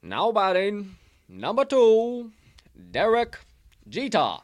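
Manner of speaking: high, loud, slow stadium PA call; ballpark announcer. Retold or imitated. Calling Jeter to the plate like a stadium announcer. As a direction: stretch each word like a ballpark announcer.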